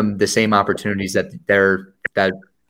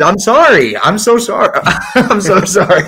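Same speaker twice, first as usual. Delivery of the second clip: laughing apology; 9 semitones higher, 4 dB louder than usual. Real, not acted. Host apologizes for assuming guest's team.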